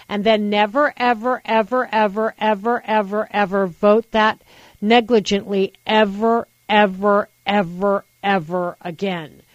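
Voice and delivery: never ever ever piled on with rising insistence; emphatic repetition. Real, not acted. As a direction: hammer every ever harder. Hammering endless never ever.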